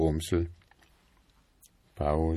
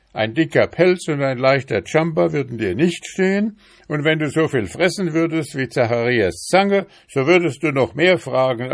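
loud, higher and fast rant; husband snaps at his wife after endless questions; exasperated shouting; 8 semitones higher, 6 dB louder, faster than usual. A narrator's reading. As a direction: let it all burst out fast and loud. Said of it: "schrie Balduin Brumsel".

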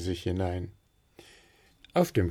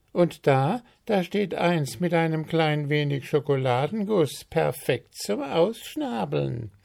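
high-pitched, eager announcement; announcing; 8 semitones higher than usual. A narrator's reading. Willi inspecting the cupboard for treats, announcing. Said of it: "verkündete Willi".

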